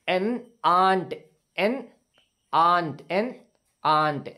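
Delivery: एन आंट said slowly, each word set apart; slow model reading. Shown as a demonstration. Teacher modelling एन आंट slowly for students. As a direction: spell out एन आंट slowly for the class. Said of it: "ये हमको अच्छे से समझना है".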